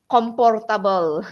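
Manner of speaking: comportable with a P sound in place of F; mispronounced comfortable. Shown as a demonstration. Mimicking trainees' common mistake, comportable with P. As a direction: swap the F for a P in comportable. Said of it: "they change the F sound to P sound".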